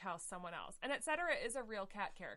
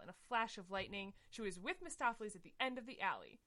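ordinary delivery

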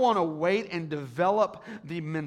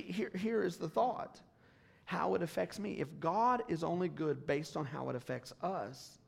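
ordinary delivery

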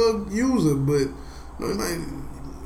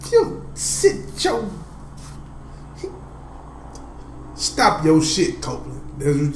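mock scolding; slower than usual. Real, not acted. Scolding friend Copeland.

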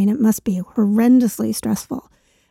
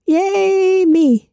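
sarcastic cheer; 9 semitones higher than usual. Real, not acted.

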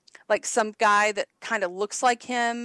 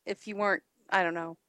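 ordinary talk, nothing marked

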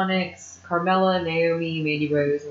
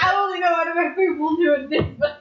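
laughing; 8 semitones higher than usual. Real, not acted. Joke, laugh and cry heard.

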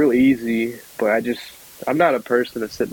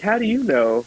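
higher, puzzled question; retold surprise; 10 semitones higher than usual. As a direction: replay your shock at grandpa, half-acted. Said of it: "I was like".

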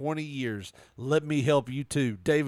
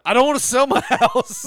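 indignant shout; 11 semitones higher, 11 dB louder than usual. Retold or imitated. Acting out reply to text.

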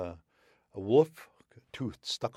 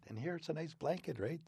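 plain reading voice

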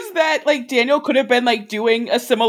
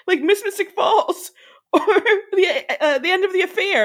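laughing; 8 semitones higher, slower than usual. Real, not acted. Laughing while listing episodes.

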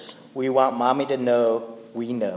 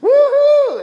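put-on much higher, louder excited child voice; excited kid; 13 semitones higher, 6 dB louder, slower than usual. Father imitating his son bragging about jumps, excited and high. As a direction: cheer like a proud little boy. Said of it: "Isaiah will come up to me and say".

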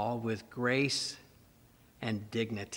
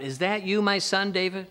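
Saul calling out, higher and louder; raised, high call across a distance; 8 semitones higher, 6 dB louder than usual. Acted. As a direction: call out in a questioning shout. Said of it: "Saul recognized David's voice and calls out".

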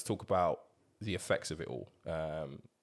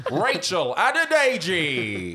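slow booming name call; dramatic announcer; 10 semitones higher, 9 dB louder, much slower than usual. Acted. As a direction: boom out the name like a show host.

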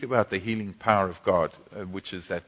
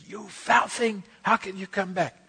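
high, indignant rebuke to the sickness; angry rebuke; 11 semitones higher than usual. Retold or imitated. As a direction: scold the illness in outrage. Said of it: "I was really angry".

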